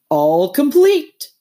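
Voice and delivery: proud Toad crowing; triumphant. Acted. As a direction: show off the locker in triumph. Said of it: "triumphantly pulling open a locker".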